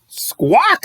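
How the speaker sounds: squeaky bird cry; crow squawk. Acted. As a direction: caw it out like a crow.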